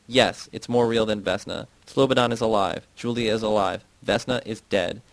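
plain reading voice

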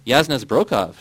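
loud, emphatic announcement; ringing exclamation of recognition; announcing. A narrator's reading. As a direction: announce the name loudly. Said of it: "she announced".

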